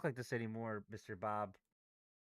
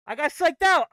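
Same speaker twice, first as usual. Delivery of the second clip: loud furious self-blame; angry shout; 10 semitones higher, 12 dB louder than usual. Real, not acted. Trader angry he got psyched out, loud shout. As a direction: yell it at yourself.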